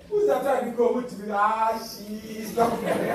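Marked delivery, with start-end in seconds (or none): 1.3-3.1 said very slowly, every word stretched out; drawn-out quote. Retold or imitated. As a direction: drag out "she is not there" slowly.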